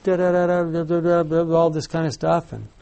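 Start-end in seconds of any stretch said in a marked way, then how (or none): 0.0-1.5 rapid-fire "da-da-da" babble, higher pitched; mock speaking in tongues. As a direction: imitate someone babbling away in tongues. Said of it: "he went off".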